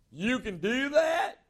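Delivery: high, slow, amazed squeak of a voice; Deacon Denny voice. Retold or imitated. Young salesman asking the preacher, in a put-on high amazed voice. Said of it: "he used the Deacon Denny accent".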